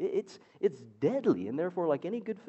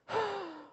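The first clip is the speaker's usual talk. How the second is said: exasperated high huff; frustrated sigh. Retold or imitated. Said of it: "frustrated sigh".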